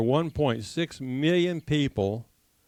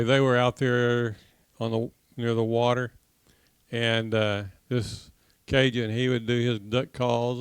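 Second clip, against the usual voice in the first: ordinary delivery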